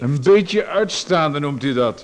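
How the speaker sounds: mocking. Visitor mocks the writer's words.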